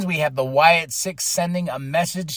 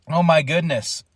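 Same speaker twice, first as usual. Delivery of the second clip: ordinary talk, nothing marked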